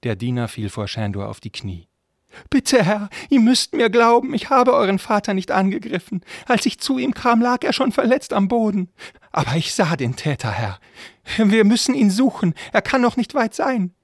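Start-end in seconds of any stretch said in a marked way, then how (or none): 2.5-13.9 very high, loud, squeaky voice; pleading frightened servant. Acted. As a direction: beg your master to believe you. (flehender Diener mit hoher Stimme)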